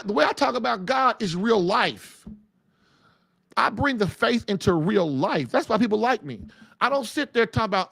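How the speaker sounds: ordinary talk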